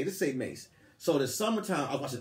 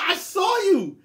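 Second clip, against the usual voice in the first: high, loud, startled yell; retold shout; 7 dB louder, much slower than usual. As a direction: shout at him when she's caught. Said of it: "She said".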